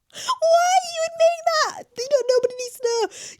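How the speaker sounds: high, loud wail of helpless laughter; appalled through laughter. Real, not acted. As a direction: squeal it out, nearly crying with laughter.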